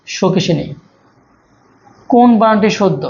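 normal talking voice

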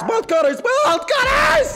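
drawn-out high, loud yell; frantic shout. Real, not acted. Player desperately hunting the bolt cutters, slow high yell. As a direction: stretch it out in desperation.

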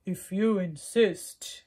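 loud, slow, booming gorilla. Acted. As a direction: boom it out like a big gorilla. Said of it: "said the gorilla".

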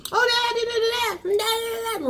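put-on high, playful kid babbling; little boy babble. Imitating the little boy running.

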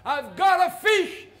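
high, very loud shout from the back; loud call. Acted. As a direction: yell it across the whole crowd. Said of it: "a voice rang out loud".

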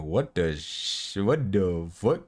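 slow, drawn-out mock disbelief; slow-motion mock bewilderment; baffled player. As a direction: imitate a stunned opponent, stretching each word. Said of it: "He's all like".

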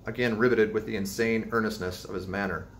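ordinary delivery

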